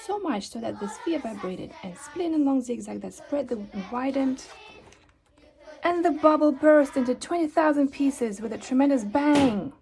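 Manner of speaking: ordinary delivery, nothing marked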